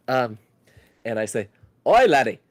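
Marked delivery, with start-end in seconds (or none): 1.9-2.4 loud, higher Canadian-tinged Scottish accent; Uncle Grunk. Shown as a demonstration. D&D player's line to get into character, loud Scottish accent. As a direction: holler it in rough-and-ready Scottish. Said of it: "I can kind of do Scottish, but it sounds more like Canadian Scottish".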